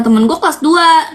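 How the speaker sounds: usual speaking voice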